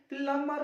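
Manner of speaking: प्लम्बर said "plummer", with no B; silent B. Shown as a demonstration. Teacher answering what a pipe fitter is called, प्लम्बर with silent B. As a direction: drop the B in प्लम्बर, keeping only the M. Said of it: "प्लम्बर बी silent हो जाएगा".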